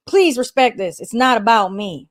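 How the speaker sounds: a mild, badly done North Carolina accent. Host reads the elder's message in a North Carolina accent. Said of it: "talk in his, his North Carolina accent".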